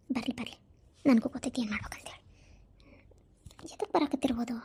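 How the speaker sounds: whispered aside, plotting to herself; scheming whisper. Acted. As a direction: hiss it under your breath so nobody hears.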